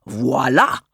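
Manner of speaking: voila said as ala, rising; mild cosmic voila; cosmic pronunciation. A narrator's reading. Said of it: "as we say in the cosmic realm".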